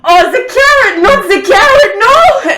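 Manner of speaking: high, loud frantic wail with a laugh; panicked cry. Acted. Cornelius crying out from the kitchen, loud panicked wail. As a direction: wail as if the carrot is doom. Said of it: "You hear from the kitchen".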